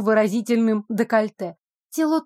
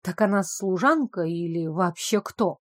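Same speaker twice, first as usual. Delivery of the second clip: ordinary delivery, nothing marked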